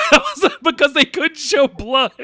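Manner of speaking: high, loud, laughing protest; laughing disbelief. Real, not acted. Podcaster scoffing at Venom's bloodless effects, laughing incredulously. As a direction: crack up while protesting.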